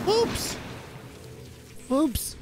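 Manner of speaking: very high, little "oops"; tiny high-pitched uh-oh; squeaky oops. Real, not acted. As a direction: squeak it after a silly mistake. Just died in the game.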